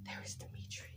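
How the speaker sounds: soft unvoiced whisper of a name; a spooky whisper; whispered dread. Real, not acted. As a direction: hush it like a bad omen. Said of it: "dreaded character name".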